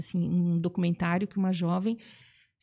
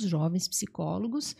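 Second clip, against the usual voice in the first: usual speaking voice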